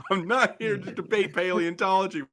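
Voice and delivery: high, loud mock fury; mock outraged rant. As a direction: rant at them, barely holding a laugh. Shopkeeper rant about dinosaurs.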